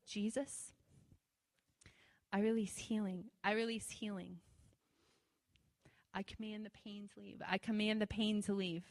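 soft, measured repeated prayer; quiet copied prayer. Retold or imitated. Repeating Joaquin's prayer word for word, quietly. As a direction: copy a healer's understated praying.

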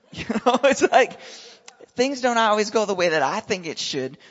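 rising preaching. Acted. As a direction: lift it to the crowd.

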